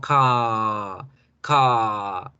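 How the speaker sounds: "ca" with the A stretched until it buzzes; long vibrating A. Shown as a demonstration. Teacher showing how the long A makes the R. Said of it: "la A empieza a vibrar".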